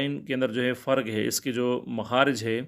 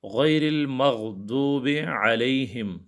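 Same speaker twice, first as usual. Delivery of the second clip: very slow, drawn-out melodic recitation; Quran recitation; 3 semitones higher, much slower than usual. Acted. Teacher recites the Fatiha for his students, slow Quranic chant. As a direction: chant each word slowly like in prayer.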